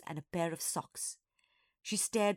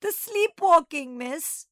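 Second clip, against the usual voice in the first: high, slow, loud wail; drawn-out distraught cry; howling; 10 semitones higher, 10 dB louder, slower than usual. A narrator's reading. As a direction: howl the explanation miserably. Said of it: "Nana howled".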